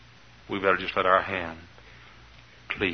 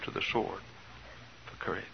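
whispered after the shouting; hushed close; 3 semitones lower, 17 dB quieter than usual. Real, not acted. To the congregation, a sudden whispered close. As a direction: breathe the last words out. Preacher drops to a hush.

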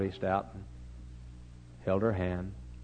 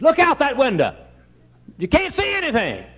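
high, loud mock shriek; panicked old lady; 10 dB louder than usual. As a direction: holler in comic alarm at your seatmate. Panicking old lady voiced in story.

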